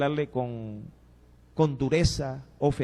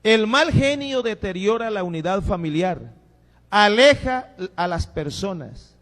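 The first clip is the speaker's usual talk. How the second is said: louder, higher sermon voice; forceful preaching; 4 semitones higher, 7 dB louder, slower than usual. Acted. Pastor warns the congregation about bad temper, preaching hard. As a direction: drive the warning home.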